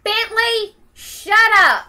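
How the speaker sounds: loud, high yell at the dog. Real, not acted. Owner yelling at barking dog, loud and high. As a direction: snap at the barking dog to stop.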